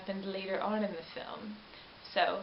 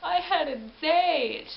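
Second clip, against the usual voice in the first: loud, high, slow dismayed lament; 8 semitones higher, 8 dB louder, slower than usual. Retold or imitated. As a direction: draw it out like a heartbroken man. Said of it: "And he's like".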